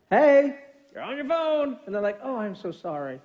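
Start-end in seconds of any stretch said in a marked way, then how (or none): 0.1-1.8 slow, high singsong reminder; friendly nudge. Acted. Instead of going. 2.2-3.2 softly acted apology; apologetic. Acted meek reply to reminder.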